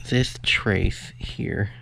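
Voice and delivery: usual speaking voice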